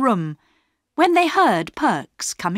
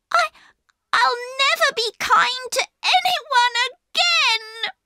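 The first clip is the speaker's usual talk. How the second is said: high, tearful voice breaking into a sob; a hurt child bursting into tears; starting to cry. A narrator's reading. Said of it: "starting to cry".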